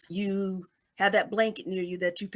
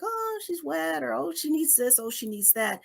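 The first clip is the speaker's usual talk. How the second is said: fussing caregiver; 6 semitones higher than usual. Acted. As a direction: imitate people fussing over a crying baby. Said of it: "people are like".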